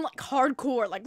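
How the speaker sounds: usual speaking voice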